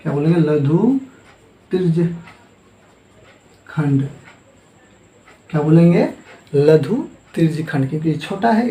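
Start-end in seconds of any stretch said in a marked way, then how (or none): 0.4-4.1 slow, broken up by long pauses; word-by-word dictation. Real, not acted. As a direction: leave writing time inside the term. Teacher spells out term while writing. (लिखते हुए रुक-रुक कर बोलना)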